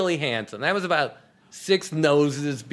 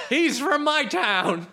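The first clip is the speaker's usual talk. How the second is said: mock indignant pride; 10 semitones higher, slower than usual. Acting out rioting soccer fan.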